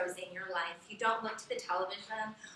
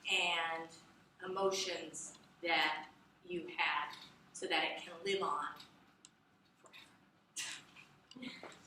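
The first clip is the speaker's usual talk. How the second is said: slow, lowered, fading close; slow down and let the voice sink to a finish; voice going down; 5 semitones lower, 5 dB quieter than usual. Shown as a demonstration. Teacher showing how a conclusion ends, voice winding down. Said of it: "how our voice goes down".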